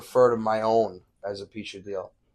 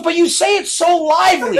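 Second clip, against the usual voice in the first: high, loud mock complaint; imitated protest; 8 dB louder than usual. Lady protesting to the video maker, high loud mock complaint. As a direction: throw it back in a raised, pleading pitch. Offended lady's protest, retold.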